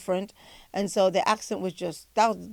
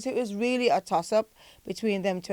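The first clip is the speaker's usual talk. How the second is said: ordinary talk, nothing marked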